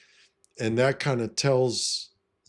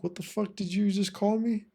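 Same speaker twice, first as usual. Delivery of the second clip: much higher, incredulous retort; indignant disbelief; 11 semitones higher than usual. Retold or imitated. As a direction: replay the outraged what-did-you-call-me moment. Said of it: "And I was like".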